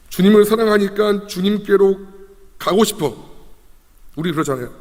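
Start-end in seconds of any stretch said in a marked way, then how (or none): 0.1-3.3 high, loud heartfelt plea; earnest longing. Acted. Preacher voices a believer yearning for the Lord, pleading. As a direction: plead it with raised pitch.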